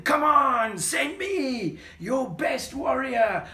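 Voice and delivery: a loud, high taunt, slowing right down. Acted. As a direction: jeer at the enemy army. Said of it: "Goliath was taunting them".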